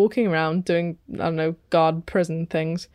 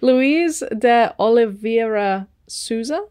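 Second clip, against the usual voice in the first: slow, careful Portuguese-accented name; attempted Portuguese accent; 2 semitones higher, 3 dB louder, slower than usual. Real, not acted. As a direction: have a go at a Portuguese accent, drawn out. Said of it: "I give that a good go".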